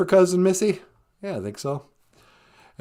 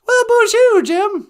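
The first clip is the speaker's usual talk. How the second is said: much higher, louder sing-song hello; mock French greeting; 8 dB louder than usual. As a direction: greet Jim with a hammed-up French flourish.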